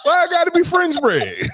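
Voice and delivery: teasing Bunny with an outraged shout; mock outrage. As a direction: yell it in fake offense.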